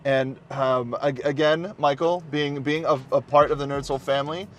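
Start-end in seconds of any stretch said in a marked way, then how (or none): none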